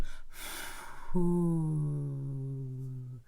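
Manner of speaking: slow, audible exhale from the diaphragm; long breath out. Shown as a demonstration. Coach demonstrates a diaphragm breathing exercise, long audible exhale. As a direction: let a long whispery breath out onto your hand. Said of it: "just try to breathe on your hand".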